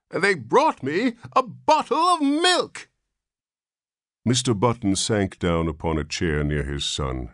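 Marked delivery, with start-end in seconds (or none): 0.1-2.9 very high, loud indignant squeal; shrill protest. A narrator's reading. Old man protesting about the milk, shrill. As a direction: let the voice climb in outrage. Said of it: "his voice rose to a shrill note of protest".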